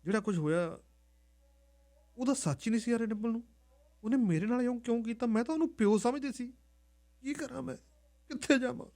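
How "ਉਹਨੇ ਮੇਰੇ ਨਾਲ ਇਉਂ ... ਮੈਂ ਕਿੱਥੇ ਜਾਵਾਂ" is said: high, hushed and lost; a scared girl asking herself what to do; girl alone in the storeroom, lost and tearful; distraught girl. Acted. Dimpl alone, crying, lost.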